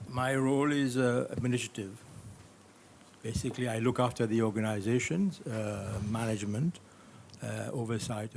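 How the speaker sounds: loud and projecting; speaking up loudly. Real, not acted. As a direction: push the voice out to be heard. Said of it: "I can't hear you".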